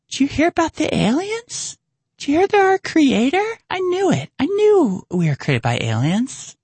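squeaky falsetto, far above normal voice; mock the believers in a very high, excited voice; mock gullible believer. Mocking gullible believers in aliens.

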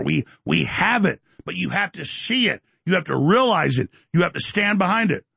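ordinary talk, nothing marked

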